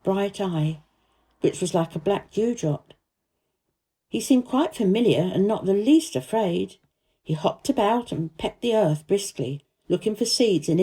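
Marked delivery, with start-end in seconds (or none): none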